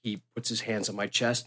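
usual speaking voice

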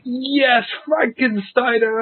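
put-on old wrestler thrilled to remember; Kobashi's excited yes. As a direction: savour the word Frankensteiner. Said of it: "And he was like".

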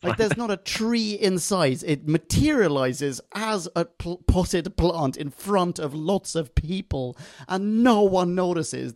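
high-pitched rant, slowing for emphasis; exasperated. Real, not acted. Host griping about the plant disguise, exasperated. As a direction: spell out the absurdity in exasperated disbelief. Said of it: "pisses me off".

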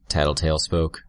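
usual speaking voice

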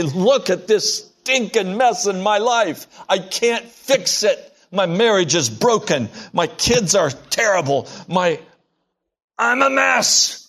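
a sinner pouring out a broken life to God; desperate confession. Acted. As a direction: plead it out, voice climbing to a high cracked "I'm a mess". Preacher voices a desperate confession.

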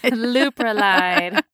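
stretched out slowly, grandly; slow regal drawl. Pretend. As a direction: savor the name like royalty. Drawn-out princess name.

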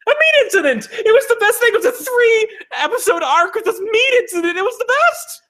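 high-pitched giddy raving with laughter; breathless delighted rave, laughing through it; excited gushing. Real, not acted. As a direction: squeal about it like a thrilled fan. Gushing about a reality show.

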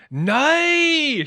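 high loud Northern Irish "Nay!"; Northern Irish exclamation. Retold or imitated. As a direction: shout "Nay!" like a Belfast no. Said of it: "what Liz is saying is".

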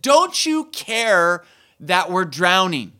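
loud, slow, angry cry at Jesus; panicked disciples shouting at their sleeping teacher; disciples yelling. Retold or imitated. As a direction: yell it slowly like frightened, furious sailors. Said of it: "started yelling at Jesus".

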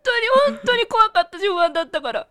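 crying while talking, voice wobbling; tearful. Real, not acted. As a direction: speak through real tears. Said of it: "ほんまに泣いてるやん".

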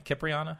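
"Kipriana" starting with "kip", as a question; hard K pronunciation. Shown as a demonstration. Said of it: "You think it's Kip?".

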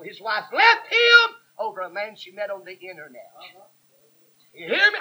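rising to a loud call, You hear me? thrown out hard; forceful preaching. Real, not acted. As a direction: drive it home to the congregation. Preacher hammering his point home.

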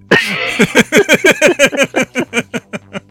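loud laugh, pitched high; hearty laugh. Real, not acted. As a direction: burst out laughing.

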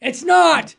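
loud, high, emphatic no; emphatic answer. Real, not acted. Host answering the priest's line, emphatic and loud. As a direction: slam the answer home.

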